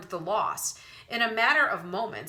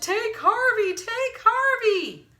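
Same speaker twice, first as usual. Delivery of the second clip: much higher, louder, slow tearful wail; high wailing sob; 8 dB louder, slower than usual. A narrator's reading. Wendell begging to be spared, high sobbing wail. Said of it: "he sobbed".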